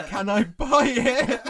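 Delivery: high, loud words breaking into laughter; can't finish the quote without laughing; laughing through quote. Real, not acted. Quoting absurd line, cracking up.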